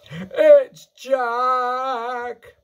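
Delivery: high, loud, drawn-out bellow. A narrator's reading. Giant spotting his enemy in a nightmare, bellowing. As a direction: bellow in panic, stretching the last word. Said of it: "bellowed the flesh lump eater".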